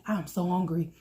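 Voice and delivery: ordinary talk, nothing marked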